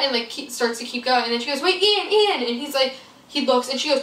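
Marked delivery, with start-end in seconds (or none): 1.6-2.4 calling out. Retold or imitated. Friend calling after Ian.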